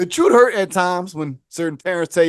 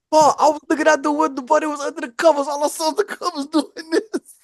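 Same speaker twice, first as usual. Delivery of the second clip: neighbor witness; 9 semitones higher than usual. Retold or imitated.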